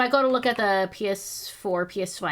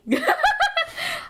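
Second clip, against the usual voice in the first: laugh; 5 dB louder than usual. Real, not acted. Laughing at own story.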